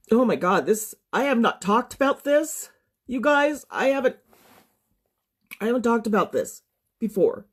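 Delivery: real distress, voice climbing high; overwhelmed, distressed. Real, not acted. Survivor telling viewers she hasn't talked about this, upset. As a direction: break off and restart, overcome. Overwhelmed while disclosing her assault.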